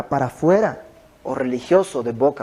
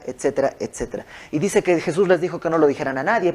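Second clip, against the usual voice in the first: usual speaking voice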